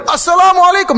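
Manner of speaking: high, loud greeting called out; loud shouted salam. Retold or imitated. Sheikh shouting his greeting at the idol a third time. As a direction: bellow the salam to the statue. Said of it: "dengan suara yang keras".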